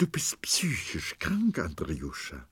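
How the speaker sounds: tear-choked whispered words; wife tells her husband through tears he is ill; crying whisper. A narrator's reading. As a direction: barely get it out through sobs. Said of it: "Sie schluchzte und bebte".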